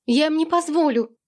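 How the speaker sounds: defiant daughter shouting to her father. A narrator's reading. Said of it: "крикнула она".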